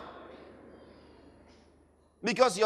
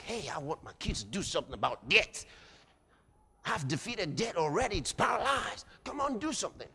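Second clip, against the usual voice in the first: softer, urging God voice; 3 semitones lower, 8 dB quieter, faster than usual. Acted. God voiced urging his children.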